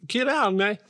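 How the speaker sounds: Killarney said high in a put-on Kerry accent; Killarney with a Kerry lilt, jokingly; mock Kerry accent.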